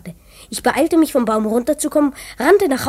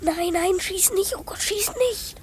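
higher, softer panicked begging; frightened pleading; 5 semitones higher, 7 dB quieter than usual. Acted. Hidden boy begs them not to shoot, high and hushed.

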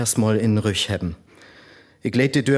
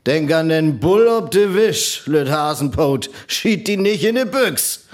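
raised warning voice; stern warning; 6 semitones higher, 3 dB louder than usual. Acted. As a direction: call out the warning sharply.

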